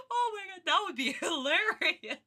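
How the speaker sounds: high, breaking up with laughter; laughing. Real, not acted. Streamer recovering from laughing fit, still giggling. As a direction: gasp it out between giggles.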